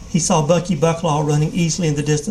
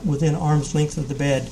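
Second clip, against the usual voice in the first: ordinary delivery, nothing marked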